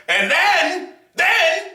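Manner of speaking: ordinary talk, nothing marked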